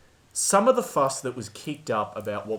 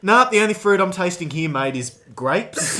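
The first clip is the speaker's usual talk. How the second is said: blunt Aussie retort; 4 semitones higher, 6 dB louder than usual. Retold or imitated. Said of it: "and I'm going".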